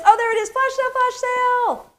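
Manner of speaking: high, loud excited call-out. Real, not acted. Host spotting the flash sale on screen, excited. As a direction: call it out high, stretching the last words.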